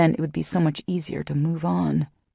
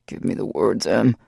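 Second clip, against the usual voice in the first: much lower, grunted order; grunted command; 9 semitones lower than usual. A narrator's reading. Lover grunting his command at Em, low. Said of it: "His grunted command".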